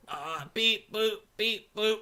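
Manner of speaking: high beep-boop robot voice; deadpan robot noises. Retold or imitated. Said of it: "deadpan robot comedian would sort of say".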